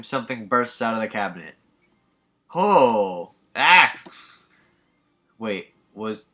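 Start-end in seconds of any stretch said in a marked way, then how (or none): 2.5-3.3 sudden loud startled cry; loud shocked shout; startled yell. Acted. Something bursts out of cabinet. 3.5-3.9 high, loud startled scream; sharp loud shriek of fright. Acted. Something bursts out of cabinet.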